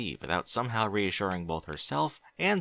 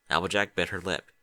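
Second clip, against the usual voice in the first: usual speaking voice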